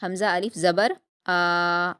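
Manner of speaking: حمزہ الف زبر آ with آ drawn out two beats, slow; stretched madd. Shown as a demonstration. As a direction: stretch the آ over two counts. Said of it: "دو fingers کو بند کریے ... جتنا تک آپ ... آ بول رہے ہیں". (آ کو دو حرکات کھینچ کر)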